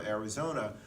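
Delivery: ordinary talk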